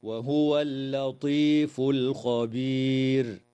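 slow measured tajweed chant with the راء of الخبير thick; Quranic recitation. Shown as a demonstration. Teacher demonstrating the stop for students, slow chanted recitation. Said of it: "هكذا".

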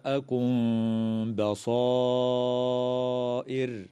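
ميم of جاءكم hidden before بصائر with a nasal hum; hidden meem. Shown as a demonstration. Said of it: "وتخفى الميم الساكنة عند الباء مع الغنة".

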